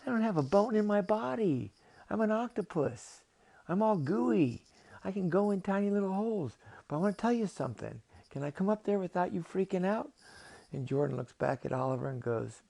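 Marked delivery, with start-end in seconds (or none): 0.1-10.1 high, loud, animated creature voice; excitable octopus, insistent and high; exasperated octopus. Acted. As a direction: protest in a pitched-up cartoon voice. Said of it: "And the octopus says".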